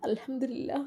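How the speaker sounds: a touch of sobbing through gratitude; sobbing softly. Acted. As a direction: fight back tears as you thank her mother. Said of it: "അവൾ വിതുമ്പിക്കൊണ്ട് പറഞ്ഞു".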